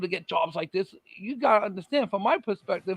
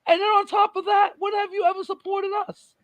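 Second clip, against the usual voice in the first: challenging; 10 semitones higher than usual. Retold or imitated. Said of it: "I said".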